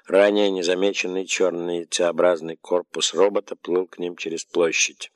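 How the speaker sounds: ordinary delivery, nothing marked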